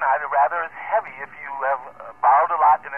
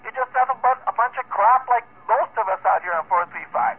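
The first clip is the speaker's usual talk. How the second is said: ordinary talk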